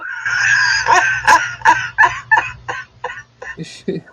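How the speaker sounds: high squealing laugh, nearly crying; shrieking laughter. Real, not acted. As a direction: laugh until you can't breathe. Friend reads crude chat names.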